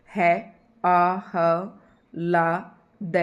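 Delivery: आह्लाद drawn out very slowly, each sound clear; careful pronunciation. Shown as a demonstration. Teacher showing students how to say आह्लाद, very slow and careful. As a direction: stretch out आह्लाद so students hear the ह and ल. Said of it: "इस तरीके से इसको उच्चारण करेंगे आह्लाद".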